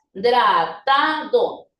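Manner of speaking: dratado slowly as dra, ta, do; syllable by syllable. Shown as a demonstration. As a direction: stretch each syllable of dratado. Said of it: "¿cómo debe quedar?".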